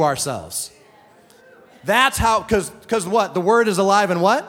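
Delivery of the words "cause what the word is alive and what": usual speaking voice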